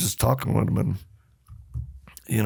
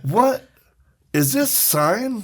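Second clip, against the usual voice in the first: much higher, stunned, slow question; shocked disbelief; 11 semitones higher, much slower than usual. Retold or imitated. Neighbor realizing the ball is signed, stunned disbelief. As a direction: gasp it out in disbelief.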